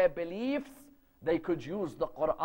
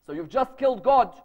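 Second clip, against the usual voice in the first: loud, higher retold reproach; outraged accusation; 4 semitones higher, 6 dB louder than usual. Mother to son about the dead idol, outraged accusation. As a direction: press the charge loudly.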